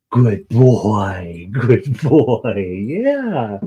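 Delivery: pet-praise voice; praising the dog. Real, not acted. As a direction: reward the dog with bright praise.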